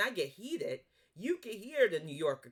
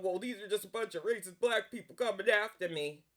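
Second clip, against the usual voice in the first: mocking whine. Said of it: "before you start going".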